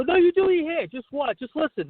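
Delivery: high-pitched, emphatic brush-off that eases down; acted-out pushy patter; retold insistent salesman. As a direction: jump in high to wave them back. Salesman directing salon customers.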